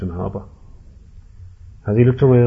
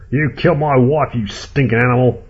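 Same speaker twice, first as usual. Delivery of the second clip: loud, raised-pitch rage; angry outburst, voice raised; furious accusation; 4 semitones higher, 6 dB louder than usual. Acted. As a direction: hurl it at your wife's killer. Ed accuses his wife's killer.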